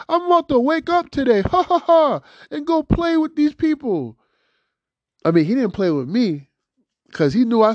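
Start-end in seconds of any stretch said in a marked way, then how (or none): 0.1-4.2 high, gleeful mock voice with a laugh; mocking imitation. Host mimics the coworker who came just to leave, mockingly. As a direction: parody him in a high-pitched gloat. Imagined words of the man who left.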